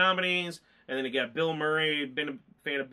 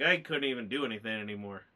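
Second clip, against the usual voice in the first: ordinary talk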